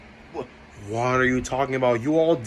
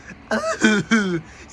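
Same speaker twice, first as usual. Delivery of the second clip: mock laugh; 7 semitones higher than usual. Guilty laugh after accusation.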